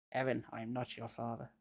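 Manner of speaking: low quiet menacing voice; quiet menace. A narrator's reading. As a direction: say it low and cold. Fake father revealed.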